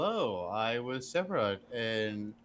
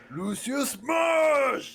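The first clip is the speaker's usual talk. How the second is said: higher, slow, drawn-out shout; berserker roar; 13 semitones higher, 6 dB louder, much slower than usual. Acted. Berserker player roaring as his character smashes, loud. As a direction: roar it like a raging brute.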